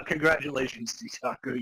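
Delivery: ordinary delivery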